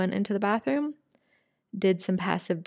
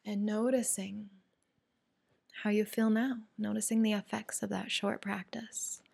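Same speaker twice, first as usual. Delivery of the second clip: calm breathwork teacher voice, softer; guided meditation; 7 dB quieter than usual. Acted. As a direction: ease them gently out of the breath hold. Leads a guided breathing practice.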